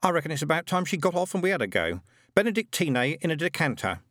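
put-on high, hurried boyish complaint; a hint of an impatient lad; lightly voiced impatient boy. A narrator's reading. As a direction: demand your turn like a schoolboy.

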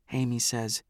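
ordinary delivery

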